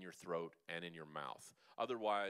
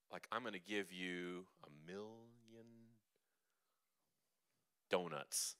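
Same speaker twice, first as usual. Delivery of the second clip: mock surprise; 3 semitones lower than usual. Shown as a demonstration. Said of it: "like you're surprised".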